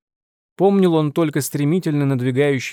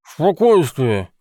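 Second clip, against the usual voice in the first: slow, louder mumble through stitched lips; mumbling; 3 semitones higher, 4 dB louder, much slower than usual. A narrator's reading. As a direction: mumble it like a mouth half-sewn. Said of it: "прошамкал Тед".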